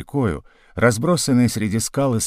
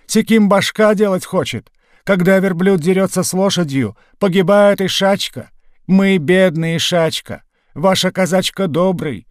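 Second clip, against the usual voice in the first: put-on pleading old Turk; 7 semitones higher than usual. A narrator's reading. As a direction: plead for your life in broken speech. Old man pleading, broken Russian.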